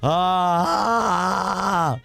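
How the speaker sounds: ordinary talk, nothing marked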